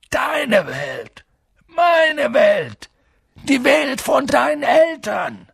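high, emphatic outburst; exasperated shouting. A narrator's reading. Giant insists on the world of the boy's parents, exasperated.

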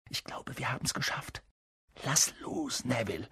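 very soft, hushed aside; whisper. A narrator's reading. Harry to Neville while hiding, soft whispering. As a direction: keep it under the breath. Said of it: "flüsterte Harry".

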